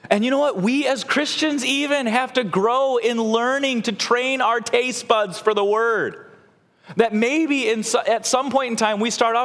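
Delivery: high, impassioned pulpit delivery; fervent preaching. Real, not acted. Preacher urging the congregation to train their taste buds, fervent. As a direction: preach it with mounting enthusiasm.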